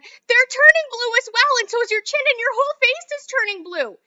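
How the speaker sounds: mother screams as Violet turns blue; screaming mother. Acted. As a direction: draw the scream out in horror.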